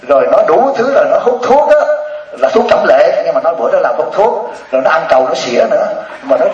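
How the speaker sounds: ordinary talk, nothing marked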